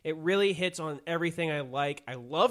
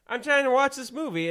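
rising, louder frustrated rant; exasperated rant; 5 semitones higher, 5 dB louder than usual. Real, not acted. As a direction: vent at the editor, getting louder. Ranting at the editor.

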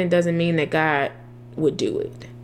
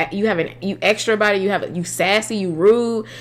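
ordinary delivery

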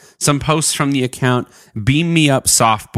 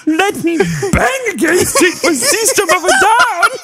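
high sing-song laughing voice; silly Dutch boy through giggles. Pretend. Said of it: "like, Dutch boy voice".

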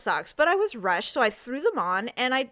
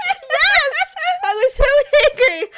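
high, loud laughing admission; real laughter while confessing; 13 semitones higher, 8 dB louder, slower than usual. Real, not acted. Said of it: "I can't stop laughing".

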